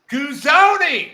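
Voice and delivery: Guzone as high, loud goo-ZO-nee; hammed-up goo-ZO-nee for Guzone; echoed Texas pronunciation. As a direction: echo Guzone with a big "ee" ending. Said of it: "we pronounce that".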